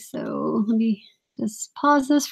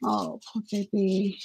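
soothing; 4 semitones lower, 11 dB quieter than usual. Real, not acted. Comforting hurt child.

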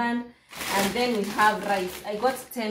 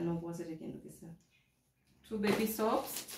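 usual speaking voice

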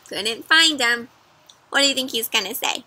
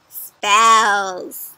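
usual speaking voice

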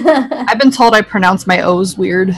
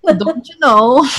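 slow, higher Minnesota accent turning a little Italian; Minnesota accent slipping Italian; 5 semitones higher, slower than usual. Shown as a demonstration. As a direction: exaggerate a Midwestern O, drifting toward Luigi. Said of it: "it's warping a little Luigi there".

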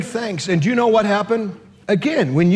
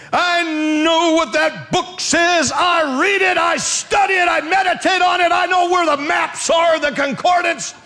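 exasperated shouted retort; 9 semitones higher than usual. Acted. Husband snapping at his Bible-quoting wife, exasperated shout. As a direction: yell it as a fed-up husband.

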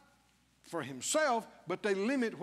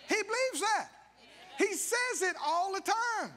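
much higher, ringing shout; preaching shout; 8 semitones higher than usual. Real, not acted. Preacher proclaiming a mentor's faith, high ringing shout. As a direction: lift it up and shout it.

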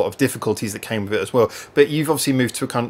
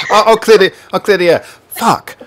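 louder, higher, playfully breaking the taboo; playful loud swear; 8 semitones higher, 8 dB louder than usual. Acted. Host clears the air for his guest, loud playful swear. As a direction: blurt it out loud for a laugh.

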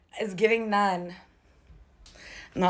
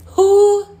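surprised shout; 11 semitones higher, 10 dB louder than usual. Real, not acted. Startled by a noise.

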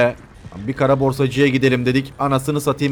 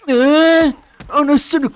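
sudden high startled cry; high yelp of alarm; 12 semitones higher, 5 dB louder, much slower than usual. Real, not acted. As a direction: yelp in shock at the attack. Sudden attack in game.

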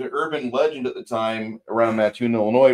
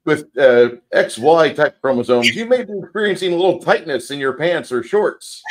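loud announcer voice; teasing mock PSA, louder; mock announcement; 3 semitones higher, 6 dB louder than usual. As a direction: deliver it like a public service notice.

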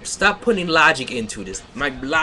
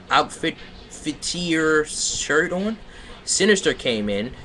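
normal talking voice

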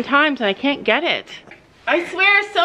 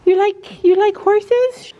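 pet talk; 8 semitones higher, 4 dB louder, slower than usual. Real, not acted. Woman coos at the barn cat in baby talk.

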